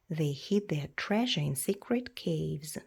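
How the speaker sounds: usual speaking voice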